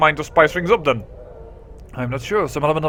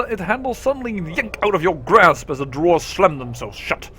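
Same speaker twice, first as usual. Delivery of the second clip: ordinary talk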